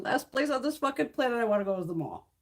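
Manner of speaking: ordinary talk, nothing marked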